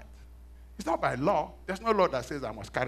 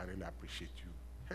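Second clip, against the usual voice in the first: tender; 8 semitones lower, 16 dB quieter than usual. Retold or imitated. Thanking his wife.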